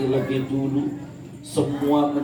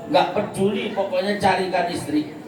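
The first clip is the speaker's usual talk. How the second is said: insistent demand; 7 semitones higher than usual. Acted.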